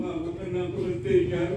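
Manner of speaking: quiet, soft imitation of a mourner's lament; soft mock crying. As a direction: murmur it like a mourner who is only starting to cry. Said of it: "Pertama dia nangisnya pelan". (tangisan pura-pura yang pelan dan lirih)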